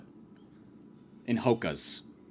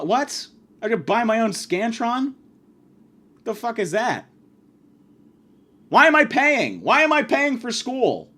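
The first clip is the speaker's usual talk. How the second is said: high-pitched, incredulous complaint; indignant retort; 8 semitones higher than usual. Retold or imitated. Broke student answers back to the teacher, high and indignant. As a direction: protest in acted outrage, pitch up high.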